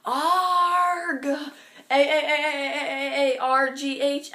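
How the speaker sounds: a hammed-up cartoon yell of frustration; mock scream, drawn out. Vlogger acts out Harry's all-caps scream as a hammed-up cartoon yell. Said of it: "when Harry Potter screams, it's".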